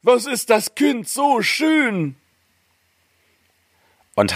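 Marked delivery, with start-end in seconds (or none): 0.0-2.2 very high, drawn-out cry of wonder; crying out in amazement. A narrator's reading. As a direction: marvel slowly in a squeaky dwarf voice. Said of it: "schrien vor Verwunderung".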